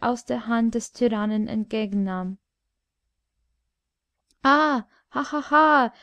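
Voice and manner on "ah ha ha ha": loud, jeering royal laugh, higher; king bellows a cruel laugh at the dwarf; bawling laugh. Acted. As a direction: roar with mocking laughter at him. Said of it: "gröhlte letzterer".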